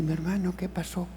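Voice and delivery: pastor speaks softly and gently; a soft, caring question to the prisoner; quiet. Retold or imitated. Pastor habla suave al preso. (pastor suave y cariñoso)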